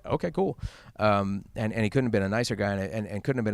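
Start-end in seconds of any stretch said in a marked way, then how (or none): 0.1-0.6 eager okay. Retold or imitated. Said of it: "I was like".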